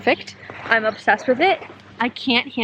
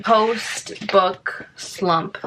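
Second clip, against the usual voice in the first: very slow, dragging; drawn out; much slower than usual. Real, not acted. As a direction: drag it out like a mock lament. Mock mourning over finished book.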